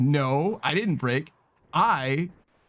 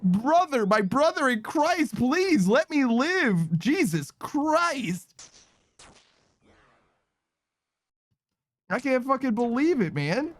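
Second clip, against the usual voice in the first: exasperated rant; 10 semitones higher, slower than usual. Real, not acted. Raging at a game hazard.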